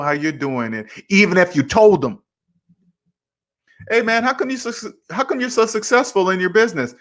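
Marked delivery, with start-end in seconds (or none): 3.9-6.9 higher, eager mock question; curious friend. Buddy asking about business success, higher eager mock voice. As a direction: lightly voice an impressed buddy.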